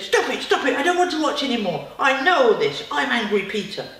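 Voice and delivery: loud, high, frantic stop it; upset protest. Acted. Peter begging the superpower to stop the film, upset loud protest. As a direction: plead it like an upset cross child.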